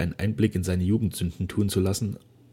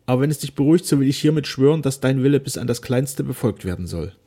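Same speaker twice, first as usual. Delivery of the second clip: raised, tearful pledge without real sobs; mildly distressed daughter, pitched higher; sobbing, understated; 6 semitones higher, 5 dB louder than usual. A narrator's reading. Daughter swearing to honour her father's will, mildly tearful. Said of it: "schluchzte sie".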